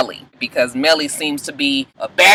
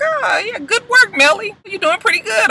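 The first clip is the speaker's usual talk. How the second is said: much higher, sing-song fake praise; mocking praise. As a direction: patronize her in a squeaky mock voice. [name] mockingly praises Melly.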